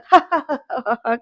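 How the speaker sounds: put-on villain's laughing fit; imitated loud laugh. Narrator imitates Vidyadhar laughing at the family, put-on laugh. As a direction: act out his big mocking laugh. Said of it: "हसायला लागतो बरं का जोरात".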